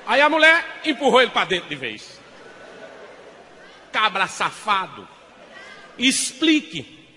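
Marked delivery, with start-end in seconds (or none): 3.9-7.1 slow, louder, spat-out accusation; slow furious demand. Acted. Wife confronting husband in cabaret, slow angry demand. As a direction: drag out each word in anger.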